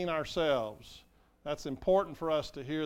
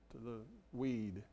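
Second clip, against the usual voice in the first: usual speaking voice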